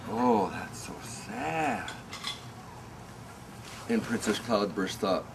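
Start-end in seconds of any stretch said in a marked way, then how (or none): 0.1-1.9 slow, soft pity; mock pity. Imitating what people think of tantrums, slow and soft. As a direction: sigh it out sorrowfully. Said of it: "but they think".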